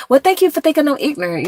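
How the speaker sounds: high, mock-grateful reply; sarcastic thanks. Real, not acted. Answering an insulting comment.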